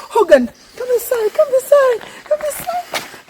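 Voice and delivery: high thrilled calling; excited call. Real, not acted. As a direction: call him in, overwhelmed. Overwhelmed host calling cameraman.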